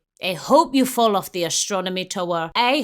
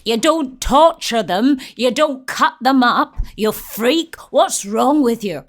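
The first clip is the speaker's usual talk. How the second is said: loud, outraged telling-off; indignant scolding; 3 semitones higher, 6 dB louder than usual. Pretend. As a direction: scold the villain on screen, loudly. Indignant scolding of film character on screen.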